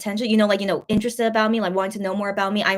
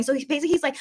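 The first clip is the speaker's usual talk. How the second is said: ordinary talk, nothing marked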